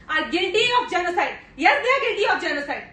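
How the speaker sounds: loud, impassioned courtroom indictment; forceful accusation. Acted. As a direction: hurl the charge at the court. (ज़ोरदार, आवेश भरा आरोप)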